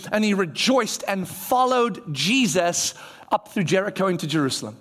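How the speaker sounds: usual speaking voice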